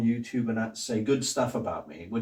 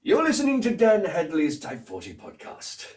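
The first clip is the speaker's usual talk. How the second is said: grand villain announcing, high and louder; the Master; 6 semitones higher, 4 dB louder than usual. Acted. Podcast intro jingle, grand commanding Master voice. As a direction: proclaim it like a commanding arch-villain. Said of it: "whoever's doing the master on that".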